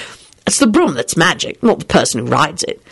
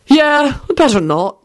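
too pleasant; 8 semitones higher, slower than usual. Acted. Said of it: "Quentin said much too pleasantly".

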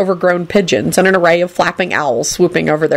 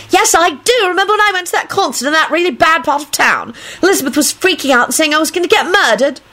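high-pitched insistent chatter; whiny, excitable Rosie; 10 semitones higher than usual. Acted. Rosie telling how she got the ring, insistent and high. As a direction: argue back in a high girlish voice.

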